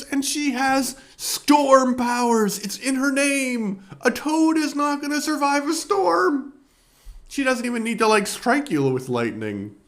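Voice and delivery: high, loud mocking rant; laughing scorn at a hopeless villain; amused disbelief. Real, not acted. Reviewer ridiculing Toad fighting Storm, high mocking disbelief.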